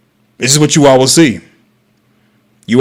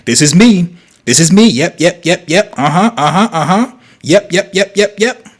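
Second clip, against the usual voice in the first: rising, higher and higher eager yeps; eager yes-woman; 5 semitones higher than usual. Retold or imitated. Streamer mocks women agreeing to anything, eager rising yeps. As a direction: nod along to everything, more and more eager. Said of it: "you'll be like".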